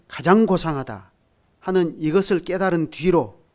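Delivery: fervent preaching. Acted. Pastor to his congregation about Paul's regrets, fervent preaching. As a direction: preach it with mounting conviction, full voice.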